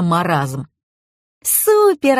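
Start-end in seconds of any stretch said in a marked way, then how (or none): 1.4-2.2 put-on very high, gleeful child's cry; delighted girl. A narrator's reading. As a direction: exclaim like an overjoyed kid. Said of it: "обрадовалась девочка".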